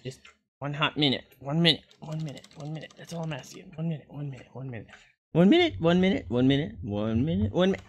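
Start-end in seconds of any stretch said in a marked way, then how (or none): 2.0-4.9 much softer, murmured repetition; soft repeated pleading. Real, not acted. Repeated quietly. 5.3-7.8 sing-song pleading. Real, not acted. Repeated loud rising.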